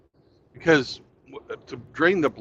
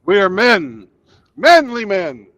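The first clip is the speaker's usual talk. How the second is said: booming macho voice; 7 semitones higher, 11 dB louder, slower than usual. Pretend. Quoting the Men in Tights song.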